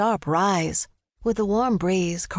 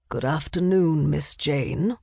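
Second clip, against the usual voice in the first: measured butler voice, a little deeper; deep, constant butler; 3 semitones lower, slower than usual. A narrator's reading. Butler answering Jane's call, deep and steady. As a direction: greet her steadily, low and unruffled. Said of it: "voice was as deep and constant".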